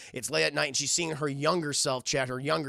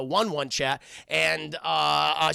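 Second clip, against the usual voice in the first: usual speaking voice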